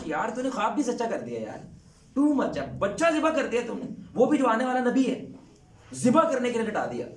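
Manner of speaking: awed, rising outcry; astonished exclamation. Retold or imitated. God voiced marvelling at Abraham.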